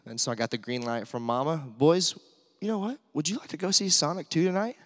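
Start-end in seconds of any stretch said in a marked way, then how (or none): 1.6-4.8 teasing offer. Retold or imitated. Dad teasing treat to sons.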